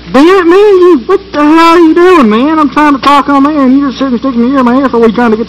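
high, exasperated telling-off; annoyed scolding. Acted. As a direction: snap at him to back off. Scolding partner mid-broadcast.

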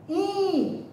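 In held high so the nose vibrates; teacher isolating the nasal In; isolated nasal syllable. Shown as a demonstration. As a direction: hum In through the nose. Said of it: "vibrou aqui o nariz".

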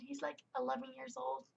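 ordinary delivery

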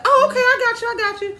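very high, loud imitation of the nurse; high-pitched acknowledgement. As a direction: squeal it as if suddenly catching on. Nurse catches on, retold.

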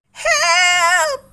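a high, loud panicked shout; terrified cry for help. Acted. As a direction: scream for help as the monster swoops down. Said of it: "he cried".

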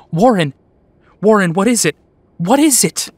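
loud, high frantic calls; terrified shouting. Acted. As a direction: yell down in terror.

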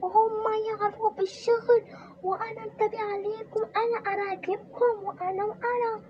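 put-on high, accusing old woman's voice; accusing mother-in-law. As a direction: accuse the daughters-in-law shrilly. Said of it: "مرة مستحيل تقول". (الحماة تتهم كنائنها بصوت مرتفع)

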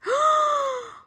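very high shocked gasp; sharp gasp. A narrator's reading. Amelia discovers Norman's secret.

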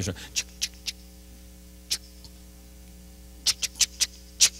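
soft voiceless "ch" clicks; imitated register noise. Preacher mimics clerk ringing up dirt, soft clicking noises. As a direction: tap out register sounds with the mouth.

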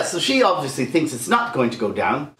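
ordinary delivery

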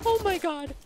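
very high, panicky exclamation; shaken squeal. Real, not acted. Gamer just after being caught off guard, panicky squeal.

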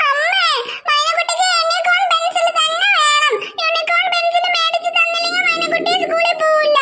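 put-on whining child voice with a cry in it; a small girl nagging her mother, half crying; whiny little girl. Little Maina begs mother for a unicorn pencil, whining tearfully.

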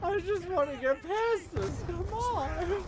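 high pleading whine; whiny plea. Real, not acted. Frustrated whine.

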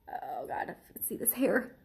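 hushed horrified whisper; real disgusted whisper. Real, not acted. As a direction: whisper it, grossed out. Horrified close look at hairy scorpion.

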